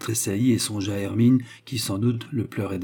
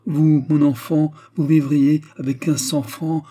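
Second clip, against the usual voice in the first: exclamation; 5 semitones higher, 7 dB louder than usual. A narrator's reading. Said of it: "s'écria Fernand".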